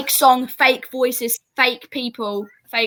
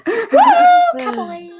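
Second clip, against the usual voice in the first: high, loud mock rodeo whoop; cowboy whoop; 6 dB louder, much slower than usual. Teasing an American about cowboy hats, mock cowboy whoop.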